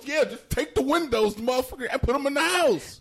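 high, heated rant; fed up, pitch way up; exasperated. Real, not acted. Heated rant about neighbor.

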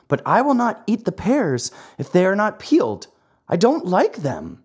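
put-on high-pitched stubborn puppet child; fussy Pinocchio. A narrator's reading. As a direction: refuse pickily in a high childish voice.

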